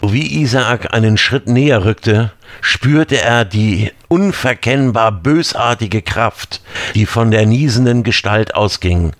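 usual speaking voice

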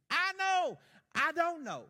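high, loud burst of conviction; loud emphatic exclamation. Real, not acted. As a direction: proclaim it with force, pitch jumping up. Preacher exclaims his certainty.

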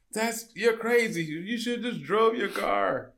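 high, slow mock disbelief; exaggerated retold astonishment; incredulous imitation. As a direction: draw it out high, imitating an amazed driver. Retells driver's amazed reply.

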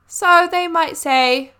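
usual speaking voice

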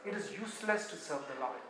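a muttered, defeated complaint; hushed complaint. Acted. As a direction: let the grumble sink to almost nothing. Israel's complaint repeated, hushed.

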